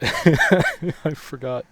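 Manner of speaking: laughing through the words. Real, not acted. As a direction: giggle out the words.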